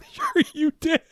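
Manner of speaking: squeaky, very high mocking voice; comic high-pitched disbelief; mock agreement. Mocking repeat of the doubting reply.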